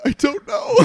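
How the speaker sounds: high giggly imitation of a confused cry; laughing mock reply. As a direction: squeak it out through laughter. Mocking friend crying at Oscars.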